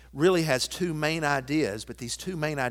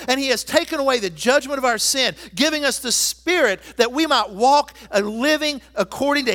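loud, high, proclaiming preacher voice; full-force preaching; 10 semitones higher, 9 dB louder than usual. Acted. Pastor rousing the church about conquered enemies, full-force preaching. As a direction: preach it out to the whole congregation.

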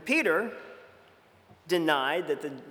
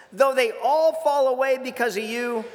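Peter protesting; 6 semitones higher, 3 dB louder than usual. Retold or imitated. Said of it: "Peter says this".

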